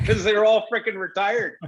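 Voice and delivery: laughing. Real, not acted. Player joking about retired numbers, laughing. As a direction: let laughter break into the punchline. Laughing at his own story.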